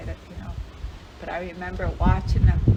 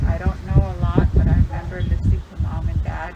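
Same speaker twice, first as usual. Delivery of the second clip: normal talking voice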